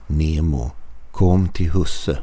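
ordinary delivery

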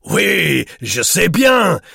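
loud, high, merry outburst in French; boisterously amused Swiss man; hilarious interjection. A narrator's reading. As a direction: jump in gleefully, drawing out the words. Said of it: "interjected Herr Vogel hilariously".

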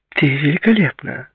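high-pitched gushing compliment; gushing friend. A narrator's reading. Girl greeting her friend at the bar, gushing. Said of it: "сказала она Клаудии".

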